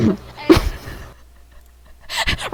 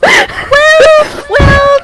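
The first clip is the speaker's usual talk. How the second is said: high, loud squeaky protest; indignant protest; 12 semitones higher, 4 dB louder than usual. Real, not acted. Protesting to Tori, high and loud. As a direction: squeal a flustered objection. Player protests blackmail accusation.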